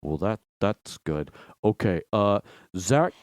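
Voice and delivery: put-on low, slow drunk kid; drunk teen boy. Dad imitating drunk Nick passing the phone, low and slow. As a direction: drawl it like a hammered teenager.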